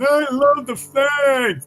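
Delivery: high, slow mocking cheer; sarcastic flag-waver voice; mocking patriot.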